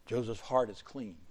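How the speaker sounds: ordinary talk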